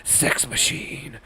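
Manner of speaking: low lecherous alien monster; a creepy monster flirting; Venom. Acted. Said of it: "He could be like".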